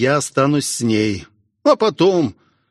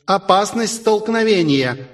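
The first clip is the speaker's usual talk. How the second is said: cool female alarm voice; 7 semitones higher than usual. A narrator's reading. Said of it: "прохладный женский голос".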